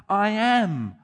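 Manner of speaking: "I am" proclaimed high and loud, both words stretched; emphatic I am. Shown as a demonstration. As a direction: hit "I am" hard, like bold capitals. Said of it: "emphatic "I am" statements".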